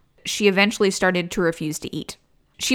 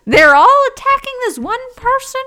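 incredulous; 12 semitones higher, 6 dB louder than usual. Real, not acted. Mocking the demon lineup.

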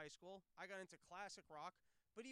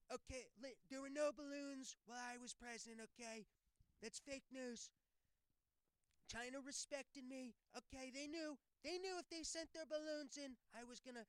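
higher, brash and boastful; Trump impression; 6 semitones higher, 3 dB louder than usual. Trump at an imagined debate boasting about the balloons. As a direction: brag like Trump on the debate stage. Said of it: "when you got Trump and Biden up there".

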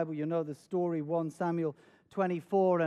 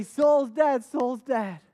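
retold eager announcement, raised at first; acted eagerness, loud then easing off; eager news; 5 semitones higher, 6 dB louder, much slower than usual. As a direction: call out the news like an eager messenger. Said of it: "some messengers come eagerly".

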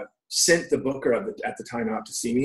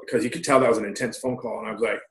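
normal talking voice

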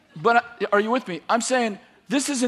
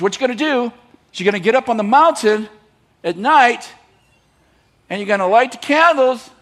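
raised voice building to a loud climax; emphatic, higher, punched-out commands; mounting battle orders; 4 semitones higher, 7 dB louder than usual. Acted. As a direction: lay out the plan with growing gusto, pausing between steps. God builds up the absurd plan.

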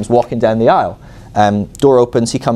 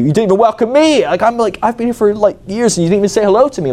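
much higher, aggrieved complaint; offended retort; 11 semitones higher than usual. Retold or imitated. Imagined retort to the pastor who ignored him, high and aggrieved. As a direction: throw the snub back at him.

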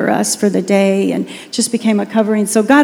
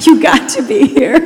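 laughing through "You got to be here", a touch higher; laughing correction; 4 semitones higher, 4 dB louder than usual. Real, not acted. Pastor laughing as she corrects herself to the congregation, amused. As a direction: laugh it off while fixing the slip. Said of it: "That did not come out right".

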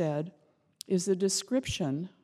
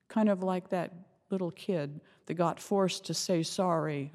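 normal talking voice

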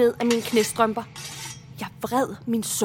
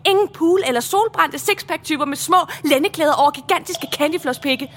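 ordinary delivery

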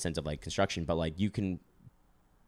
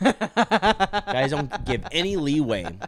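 laughing; 8 semitones higher, 7 dB louder, much slower than usual. Real, not acted. Laughing complaint about vegetarians.

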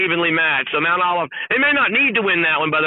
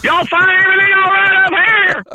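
high, hammed-up welcome voice; mock greeter; 13 semitones higher than usual. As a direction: play the cheesy Dunk Mountain welcomer. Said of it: "Can you imagine going out there like".

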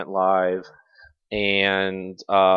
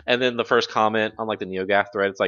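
ordinary delivery

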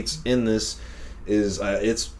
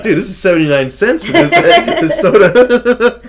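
high, loud quote breaking into laughter; laughing mock protest; 13 semitones higher, 9 dB louder than usual. Friend quoting the soda owner demanding money, laughing mock protest.

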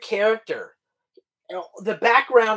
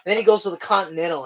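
ordinary delivery

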